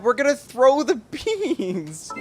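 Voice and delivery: can barely get it out for laughing. Real, not acted. Giggling at throwing beans.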